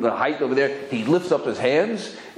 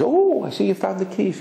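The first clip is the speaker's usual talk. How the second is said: voicing the wife; 11 semitones higher than usual. Wife voiced in story.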